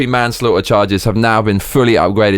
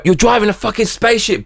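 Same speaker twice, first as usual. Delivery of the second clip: ordinary talk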